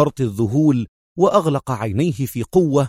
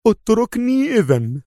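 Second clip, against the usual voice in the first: high, insistent demand; firm; 11 semitones higher than usual. A narrator's reading. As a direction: stand your ground in a high pitch. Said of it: "في حزم مماثل".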